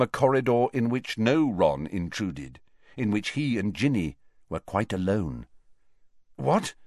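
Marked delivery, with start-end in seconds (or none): 6.4-6.7 startled, higher, rising question; confused. Acted. Harry caught daydreaming by Ron, confused. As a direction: ask as if jolted from a daydream. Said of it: "he said confusedly".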